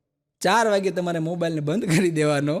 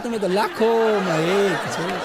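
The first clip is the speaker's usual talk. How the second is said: slow, teasing repeat of the wife; wife's sweet line; slower than usual. Retold or imitated. Comedian quoting his wife to the audience, slow teasing repeat. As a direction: drag the words out as the wife. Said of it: "એ કે".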